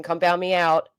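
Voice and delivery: ordinary delivery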